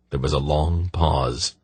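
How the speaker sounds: plain reading voice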